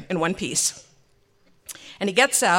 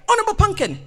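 high, loud husband's summons; calling out; 13 semitones higher, 7 dB louder, faster than usual. Retold or imitated. Husband summons his wife to the car, grand call. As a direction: call out grandly with pitch raised.

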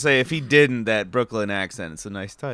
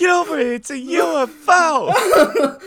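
high, loud mimicked shouting; excited yelling; 7 dB louder than usual. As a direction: echo the neighbor's amazed yell. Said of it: "mimicking the neighbor".

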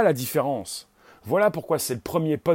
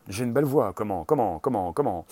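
much lower, falling repeats of "comment"; deep echo; 5 semitones lower than usual. Acted. Host plays with own voice at the mic, deep echo. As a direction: drop low and echo yourself. Same words repeated in dropped voice.

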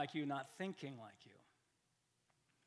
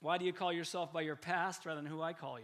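ordinary talk

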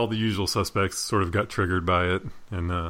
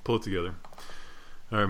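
normal talking voice

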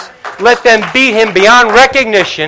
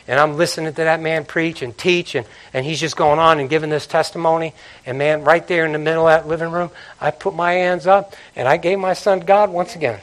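much softer, intimate telling; hushed testimony; 4 semitones lower, 7 dB quieter than usual. Real, not acted. Pastor recounting a late-night surrender, hushed. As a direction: drop right down and draw them in.

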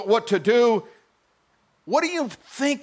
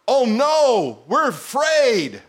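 slow, louder hammed-up fright; mock fear; 4 semitones higher, 4 dB louder, much slower than usual. Disciples panicking in the storm, hammed-up fear. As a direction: whine it like scared fishermen.

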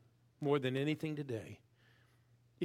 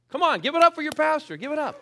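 loud, fast call to applaud, pitched high; rallying shout; 14 dB louder, faster than usual. Real, not acted. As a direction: whip the crowd into cheering. Pastor rallies congregation to applaud.

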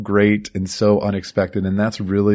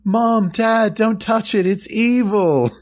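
high-pitched pleading kid, hammed up; panicked child warning; 13 semitones higher, slower than usual. Boy begging parents not to touch, high and pleading. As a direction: squeal it like a scared boy. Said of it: "kid warning his parents".

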